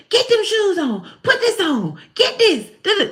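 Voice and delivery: hammed-up Sunday morning nag; nagging parent. Parent fussing at children to get dressed for church, high mock nag.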